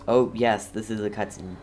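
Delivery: usual speaking voice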